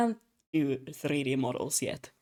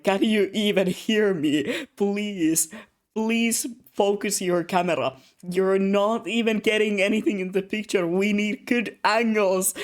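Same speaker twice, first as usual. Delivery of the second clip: exasperated pleading; 5 semitones higher, 5 dB louder than usual. Retold or imitated. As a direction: beg the camera operator to wake up. Pleading with a spaced-out camera operator.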